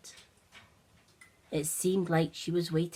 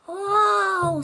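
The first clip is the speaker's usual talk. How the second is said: high loud whoa of wonder; amazed whoa; 13 semitones higher, 10 dB louder than usual. Acted. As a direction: gasp out in awe. Olivia amazed by flames.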